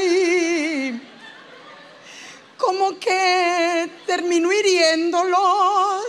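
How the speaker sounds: very slow, drawn-out whine; hammed-up therapy patient, haltingly dragging every phrase; self-pitying patient. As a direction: stretch the last vowels into a near sing-song moan. Acting a whiny therapy patient.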